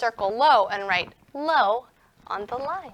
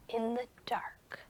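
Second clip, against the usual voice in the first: whisper; 3 semitones lower, 14 dB quieter than usual. Acted.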